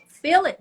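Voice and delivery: sudden high, loud push on feel; emphatic command. Shown as a demonstration. Coach urging her audience, loud emphatic insistence. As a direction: coach the listener to truly feel it. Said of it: "Don't just think it".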